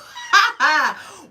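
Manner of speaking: a loud, sudden laugh at her own tears; a burst of self-conscious laughter; embarrassed laugh. Real, not acted.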